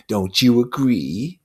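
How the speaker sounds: put-on a buzzing devil taunting a child; Lord of the Flies, mocking. Lord of the Flies taunting Simon, slow and sneering.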